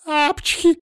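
high-pitched "apchhi"; acted sneeze. A narrator's reading. Маша sneezes while scolding the little animal, acted sneeze.

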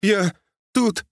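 very high, helpless pleading. A narrator's reading. Frightened motorist begins excusing himself to the sergeant, pleading. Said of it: "взмолился автолюбитель".

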